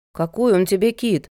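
mildly indignant woman's rebuke; a touch of outrage. A narrator's reading. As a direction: pull the child up, affronted. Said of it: "возмутилась Лена".

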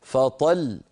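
slow فطل with the final lam pushed; فطل held slowly with a firm final l; pressed doubled lam. Shown as a demonstration. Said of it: "هكذا".

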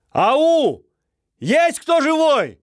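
high drawn-out call; slow, loud hollering into the distance. Acted. Calling out across empty yards.

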